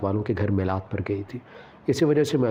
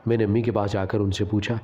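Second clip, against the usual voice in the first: ordinary delivery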